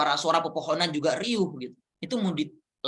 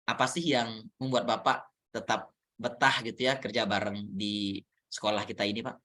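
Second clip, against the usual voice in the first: soft, lower, calm asking voice; gentle question; 7 semitones lower, 4 dB quieter than usual. Shown as a demonstration. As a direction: coach demonstrating a soft, caring question to a teacher. Coach role-plays asking a teacher.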